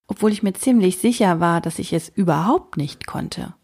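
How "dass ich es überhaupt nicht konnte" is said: ordinary delivery, nothing marked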